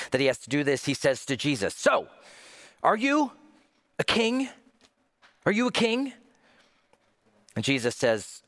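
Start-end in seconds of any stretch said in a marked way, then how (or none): 1.7-6.1 much higher-pitched exasperated questioning; frustrated, annoyed questioning. Acted. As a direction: ask it steaming with irritation, pitched way up. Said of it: "frustrated, he's annoyed, he's steaming".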